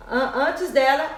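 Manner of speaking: ordinary delivery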